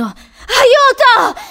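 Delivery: very high, loud, drawn-out scream; sudden piercing cry for help. A narrator's reading. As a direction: scream for help at full force. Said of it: "riprese a urlare".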